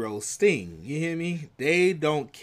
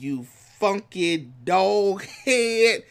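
quoting [name]; 5 semitones higher, 5 dB louder, much slower than usual. Retold or imitated. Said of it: "And he said".

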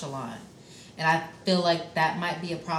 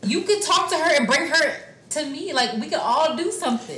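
rising, louder, eager; excited; 9 semitones higher, 4 dB louder than usual. Real, not acted. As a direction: gush about it, pitch up high. Excited about open relationships.

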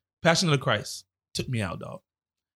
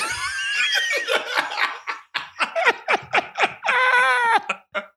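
howling laugh, far higher. Real, not acted. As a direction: break up laughing. Laughs at retold jab.

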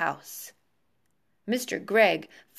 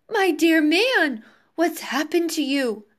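alarmed cry; 6 semitones higher, 4 dB louder than usual. A narrator's reading. Wife to her transformed husband, alarmed cry. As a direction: cry out in horrified disbelief. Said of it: "cried Missus Gregg, going purple".

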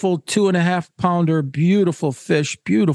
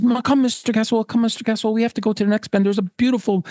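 higher-pitched, urging call; eager gillie; 7 semitones higher, faster than usual. Acted. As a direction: urge the angler on to the next fish. Gillie urging angler onward again.

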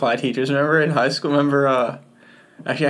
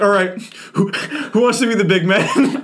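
mock teacher challenge; 5 semitones higher than usual. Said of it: "He was like".

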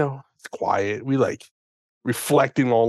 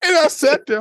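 laughing; 13 semitones higher, 6 dB louder than usual. Real, not acted. Laughing while retelling absurd story.

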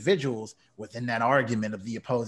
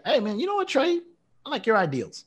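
mock-eager friend, pitched much higher; 9 semitones higher than usual. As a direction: play an eager buddy signing on, voice jumped way up. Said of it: "you can say".

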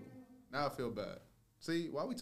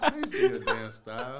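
laughing; 10 dB louder than usual. Real, not acted. Denying while laughing.

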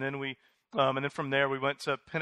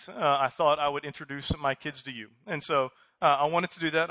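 ordinary delivery

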